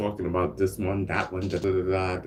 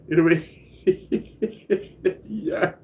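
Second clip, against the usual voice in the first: laughing drawn-out question; 12 semitones higher, 5 dB louder, much slower than usual. Real, not acted. Laughing after telling off viewer.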